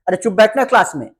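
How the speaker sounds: quick, loud mock scolding of a class; scolding teacher. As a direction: snap at the class like a strict teacher. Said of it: "teacher डांटते थे". (डांटते teacher की तरह)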